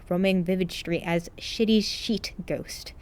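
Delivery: ordinary delivery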